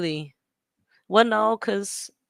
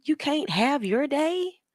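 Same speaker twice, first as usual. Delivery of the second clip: imitating her indignation, higher pitch; higher-pitched mock outrage; mock upset; 6 semitones higher than usual. Retelling the upset woman's rant to viewers, mock indignant. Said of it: "upset. She was like".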